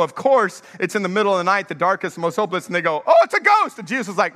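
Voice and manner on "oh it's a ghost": high, loud, startled yelp; panicked cry. Acted. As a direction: cry out in mock terror at a ghost. Disciples panic seeing a ghost.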